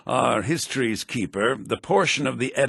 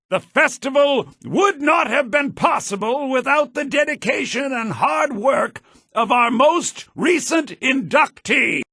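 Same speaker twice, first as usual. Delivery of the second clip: raised, high voice projected to a crowd; public address; 11 semitones higher, 5 dB louder than usual. Acted. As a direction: announce to the whole square. Herald addresses the festival crowd.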